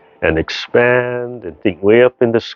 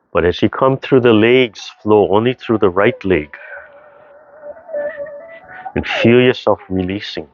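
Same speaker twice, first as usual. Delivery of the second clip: guided movement cue; 2 semitones higher than usual. Acted. Tai chi teacher leading seated practice, gentle guided cues.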